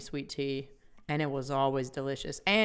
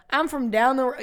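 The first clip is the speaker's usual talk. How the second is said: indignant retort; 8 semitones higher, 7 dB louder than usual. Retold or imitated. Retold reply.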